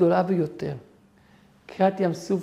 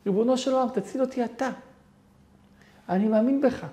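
higher-pitched pleading cry to God; desperate plea; 6 semitones higher than usual. Retold or imitated. Man falling off a cliff begging God, higher pleading cry. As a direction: beg from the edge of the cliff.

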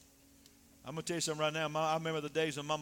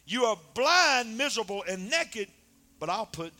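full-force preaching; 7 semitones higher, 10 dB louder than usual. Acted. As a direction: drag each word out, loud and heavy.

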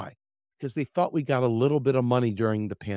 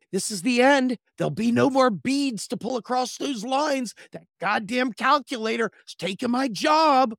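much higher and louder, cartoon panic; panicked abacus; 11 semitones higher, 5 dB louder than usual. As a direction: wail like a doomed cartoon abacus. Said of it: "abacuses going".

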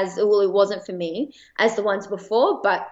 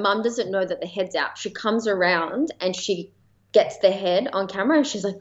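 ordinary talk

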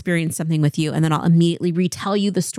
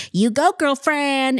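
put-on slow, high sassy girlfriend voice; drawn-out sassy put-on; mock sassy slang; 8 semitones higher, slower than usual.

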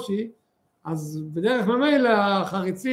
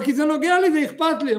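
high, loud insistent cry; shouting; 7 semitones higher, 5 dB louder than usual. Retold or imitated. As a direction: shout that it matters to you. Guest still yelling at host.

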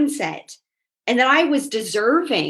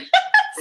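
quick high laugh; short laugh; 3 dB louder than usual. Real, not acted. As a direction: laugh at your own assumption. Laughs at her own assumption.

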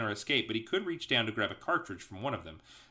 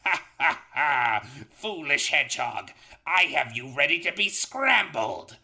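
high, loud cackling villain; Robotnik's fiendish voice; 4 semitones higher, 7 dB louder than usual. Acted. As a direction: gloat like a mad scientist. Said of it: "Robotnik's fiendish voice".

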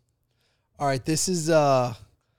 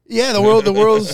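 excited; 7 semitones higher, 10 dB louder than usual. Real, not acted. Excited repeating the nickname.

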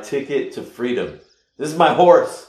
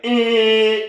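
horn honk imitation; 12 semitones higher, 5 dB louder than usual. Imitating the car horn blaring.

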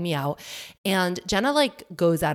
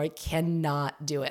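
ordinary delivery

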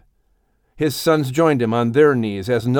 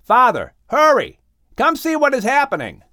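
urgent high call from below the deck; a son shouting up to his father in alarm; 11 semitones higher, 3 dB louder than usual. A narrator's reading. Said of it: "heard Ham calling him from above decks".

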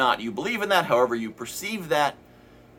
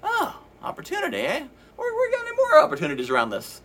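much higher, giddy inner voice; excited subconscious. Pretend. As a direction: squeal it like an eager inner child spotting a treat. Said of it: "subconscious now saying".

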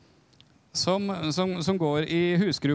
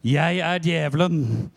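distorted voice; 4 dB louder, slower than usual. Retold or imitated. Possessed woman answering the pastor, slow distorted demon voice. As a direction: let a demon speak through her, slow and warped. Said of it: "en forholdsvis fordreid stemme".

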